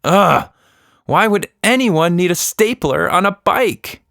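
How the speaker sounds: put-on a tired child fed up; worn-out kid voice, exasperated; exasperated girl. Exhausted Tilda complains.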